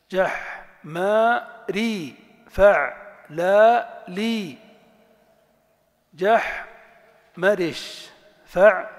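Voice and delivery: slow, loud, broken into syllables; syllable-by-syllable drill. Shown as a demonstration. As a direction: tap out each syllable like a teacher. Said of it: "syllable-by-syllable drill".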